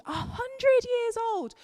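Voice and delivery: put-on "a hundred years old" jumped way up into a high, squeaky, slowed voice; squeaky emphasis. Shown as a demonstration. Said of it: "emphasizing the words".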